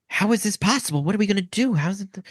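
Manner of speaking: high, worried villager voice; panicked villager. Acted.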